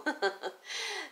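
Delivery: short breathy laugh; nervous laugh. Real, not acted. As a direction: laugh it off after a wobble. Laughing at wobbling on ladder.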